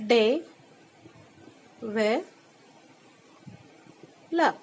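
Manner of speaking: "de, ve, la" said one syllable at a time with long pauses; split into syllables. Shown as a demonstration. Teacher showing students the syllable division, syllable by syllable. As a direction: break "de, ve, la" into separate beats. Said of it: "This is the syllable division".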